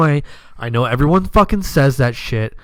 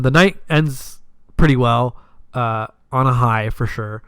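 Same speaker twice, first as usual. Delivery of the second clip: ordinary talk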